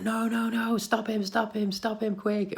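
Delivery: high, frantic mock panic; shrill rush of no no no to stop him; panicked kids yelling. Children panicking as their giggling brother wets himself, frantic high yell.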